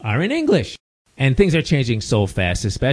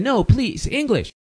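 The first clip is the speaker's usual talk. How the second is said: much higher-pitched mock plea; imitating the doctor; 10 semitones higher, slower than usual. Doctor stops his Spanish again, imitated high plea. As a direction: jump up high to imitate her pleading. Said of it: "Then she said again".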